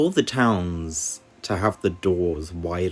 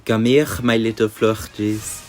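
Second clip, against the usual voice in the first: theatrical French makeup artist; French accent. Acted. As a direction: lay on a fancy French accent. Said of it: "that terrible accent that I just did".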